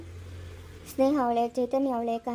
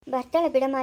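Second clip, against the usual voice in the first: usual speaking voice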